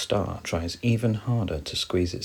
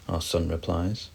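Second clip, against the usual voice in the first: ordinary delivery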